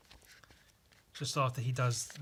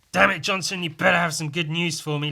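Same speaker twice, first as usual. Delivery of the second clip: loud, higher barking at Johnson; angry shout; 4 semitones higher, 9 dB louder than usual. Acted. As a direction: yell it like a furious boss. President yelling in comic.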